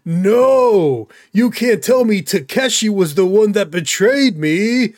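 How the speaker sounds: loud, high, drawn-out melodramatic outcry; wildly overdone, wailing betrayal in a bad dub; overacted anime dub. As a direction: ham it up like a betrayed anime hero. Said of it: "it's either overacted or it's that".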